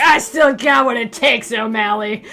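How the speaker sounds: slow, loud tough-guy comeback; gritty noir detective. Pretend. Detective Silver answers the cop, gritty noir voice. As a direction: say it like a grizzled private eye.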